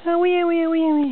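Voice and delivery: high, bouncy repeated where. Real, not acted. Playful baby game.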